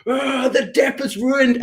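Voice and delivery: high, slow wail of complaint; mock dismayed groan. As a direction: moan it like the let-down bandmates. Band members' dismay, retold.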